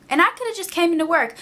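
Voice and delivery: normal talking voice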